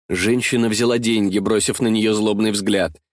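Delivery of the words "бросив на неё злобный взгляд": ordinary delivery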